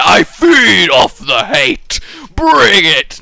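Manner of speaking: loud, drawn-out villainous boast; mock defiant shout. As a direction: roar it as a playful challenge.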